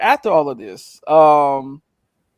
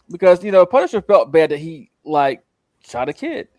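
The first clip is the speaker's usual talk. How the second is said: ordinary delivery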